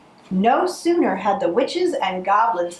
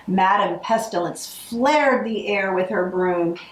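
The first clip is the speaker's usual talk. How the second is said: ordinary delivery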